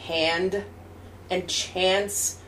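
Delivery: "hand" and "chance" with a New Jersey vowel; slow, low New Jersey pronunciation demo; New Jersey accent. Shown as a demonstration. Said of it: "That's New Jersey".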